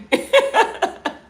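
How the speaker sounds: squeaky airy giggle; high-pitched breathy laugh. Real, not acted. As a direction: giggle up high at her own joke. Laughs after joking remark.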